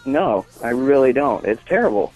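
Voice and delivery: ordinary talk, nothing marked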